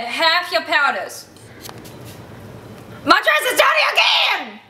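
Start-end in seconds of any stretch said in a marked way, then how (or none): 3.1-4.6 put-on whiny little girl. High wail over dirty dress.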